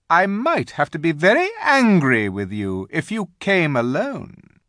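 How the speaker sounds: high, loud, emphatic queen; insistent Queen. A narrator's reading. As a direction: press the boy like a commanding queen. Queen instructs and warns.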